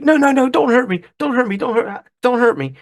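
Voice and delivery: high, hammed-up whimper of fake fear; mock cowardly pleading. Fonz pretending to cower before the kid's father, high mock-frightened pleading. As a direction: play the tough guy begging like a scared kid.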